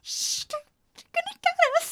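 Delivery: high, slow stage whisper; mock-frightened hushing. Viewer at home fearing the aliens, panicky hush. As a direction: hush them in pretend panic.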